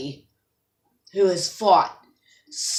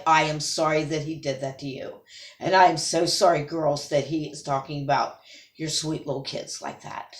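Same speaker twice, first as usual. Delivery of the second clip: sincere apology; 6 semitones lower, 4 dB quieter than usual. Real, not acted. Apologizing to the mothers.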